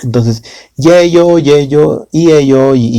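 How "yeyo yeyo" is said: yeyo said slowly, pitch raised on the first; drawn-out yeyo. Shown as a demonstration. Teacher modelling yeyo slowly for Korean learners. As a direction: stretch yeyo clearly as a model word. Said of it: "yeyo es la variación del verbo ida".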